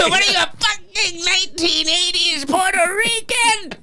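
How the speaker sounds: mock heckler. Mocking heckle.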